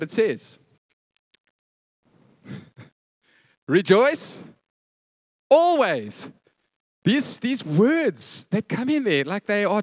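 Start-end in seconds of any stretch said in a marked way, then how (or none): 3.7-6.3 "Rejoice" and "always" called out loud; preacher proclaims the verse to the church, loud; proclaimed verse. Acted. Loud, ringing scripture proclamation.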